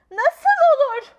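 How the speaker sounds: high, loud slow astonished question; stunned disbelief; shocked gasp. A narrator's reading. Mother learns rhino is real. (şaşkınlıktan donakalan anne)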